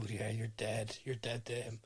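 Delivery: low, very soft aside; hushed mock dread. Host imagining the reporter's fate, hushed mock dread. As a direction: drop down low and quiet, as if doomed. Voicing his dread at manager's threat.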